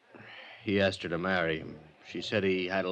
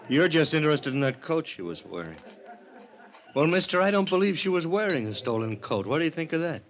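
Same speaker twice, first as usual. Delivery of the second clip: defensive outburst; 6 semitones higher, 3 dB louder than usual. Acted. Ex-husband bristles at investigator.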